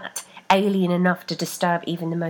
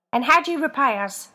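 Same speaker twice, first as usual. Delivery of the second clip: higher, louder reproach; reproachful foot; 7 semitones higher, 5 dB louder than usual. A narrator's reading. Foot reproaching Rebecca, higher reproach. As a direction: demand an answer.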